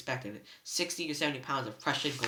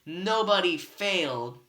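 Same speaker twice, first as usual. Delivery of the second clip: gloating Cyclops; 5 semitones higher, 7 dB louder, slower than usual. Acted.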